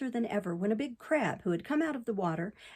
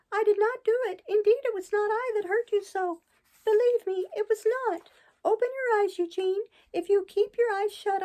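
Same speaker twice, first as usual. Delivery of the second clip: high, louder despairing puppet lament; crying bitterly; 11 semitones higher, 4 dB louder than usual. A narrator's reading. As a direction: plead with the fallen friend, near tears. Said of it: "Crying bitterly in his despair".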